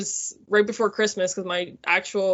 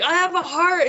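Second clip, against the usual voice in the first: a much higher, eager burst of news; excited announcement; 9 semitones higher, 3 dB louder than usual. Retold or imitated. Patient phoning her best friend at night, excited. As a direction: blurt it out thrilled.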